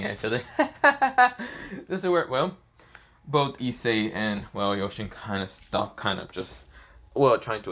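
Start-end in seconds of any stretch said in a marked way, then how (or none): 0.5-1.8 loud, high staccato laugh; acted burst of high giggles; giggling chuckle. Two rivals drop their fight and laugh together, giggling and chuckling. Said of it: "start just giggling and chuckling".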